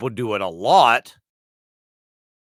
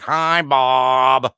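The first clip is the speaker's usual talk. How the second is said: class greeting chorus; 6 dB louder than usual. Retold or imitated. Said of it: "why did we all speak with that cadence".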